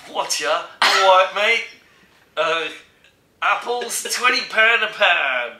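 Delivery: loud Cockney market trader; high, hammed-up London barrow-boy shout; London accent. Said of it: "He was talking pure London".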